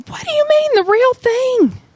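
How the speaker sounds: the dad bristling at the question; offended dad. Retold or imitated. Defensive dad protesting to Jesus, high and indignant. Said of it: "The dad is like".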